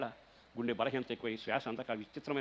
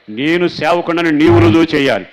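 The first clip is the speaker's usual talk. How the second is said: shouted prayer, much louder and higher; preaching at full force; 4 semitones higher, 21 dB louder, slower than usual. Acted. Pastor repeating the prayer to the church, shouting it. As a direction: cry the same line out at full voice.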